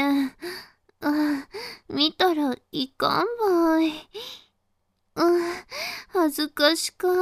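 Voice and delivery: put-on bashful, stammering Hakata-dialect girl, flustered at being watched; shy and hesitant, pleading to be let off the teasing; a flustered young woman speaking Hakata dialect to her teasing listener; embarrassed Hakata girl. Plays a shy girl being teased.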